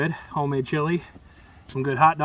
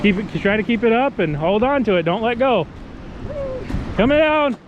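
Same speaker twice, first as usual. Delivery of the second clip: fighting a fish; 6 semitones higher than usual. Real, not acted. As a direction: urge him on as the fish runs. Father coaching son fighting fish.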